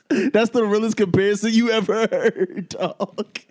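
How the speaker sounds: cracking up at his own joke; real laughter while talking; laughing. Real, not acted.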